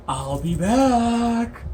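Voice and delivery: high, very drawn-out mock farewell; mock horror-trope line. Player quotes a doomed trope line, mock-dramatic. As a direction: stretch it out, hammed up. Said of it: "don't say".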